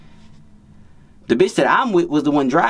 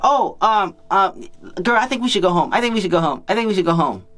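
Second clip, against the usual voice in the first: mimicking the friend; 6 semitones higher than usual. Girl's friend voiced, high.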